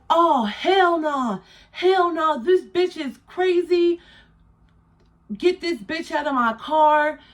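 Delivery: high, outraged mock voice of the guys throwing her out. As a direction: play the driver's crew, high and disgusted. Guys refuse, kicking her out.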